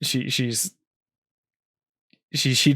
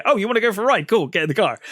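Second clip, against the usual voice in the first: higher-pitched, falsely eager imitation; pushy mock invitation, pitched up; retold mock enthusiasm; 8 semitones higher, much faster than usual. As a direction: act out a bully pretending to be delighted. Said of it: "he's like".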